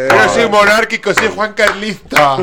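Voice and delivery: high, squealing mock monarchist fan. A fan greets the king, mock high gushing.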